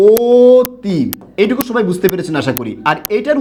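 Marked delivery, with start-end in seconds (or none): 0.1-1.0 অতি said with a stretched first vowel; emphatic অতি. Shown as a demonstration. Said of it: "এখানে আমরা উচ্চারণটা করছি কি?".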